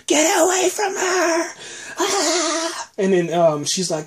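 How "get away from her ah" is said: high, slow mock shout; mock yell. Carol bursting out at the walker, a high mock shout. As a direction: yell to chase off the danger.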